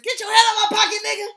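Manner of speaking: loud, fast, high put-on Malcolm X shout; fast, loud Malcolm X impression yelling at a pickpocket. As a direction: bark it out as a fiery Malcolm X. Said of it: "in my Malcolm X voice".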